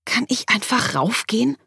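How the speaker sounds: high, pleading whine; plaintive. A narrator's reading. Patient asking receptionist to go upstairs, plaintive. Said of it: "sagte ich in klagendem Ton".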